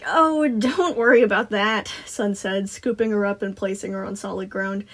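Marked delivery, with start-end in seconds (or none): 0.1-1.9 loud, swooping cheerful brush-off; Sun, dismissing worry. A narrator's reading. Sun reassuring a newly arrived girl, breezy brush-off. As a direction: wave the worry away.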